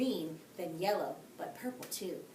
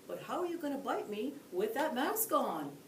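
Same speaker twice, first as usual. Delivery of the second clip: moose character voice; 5 semitones higher than usual. A narrator's reading. Mucky replies calmly.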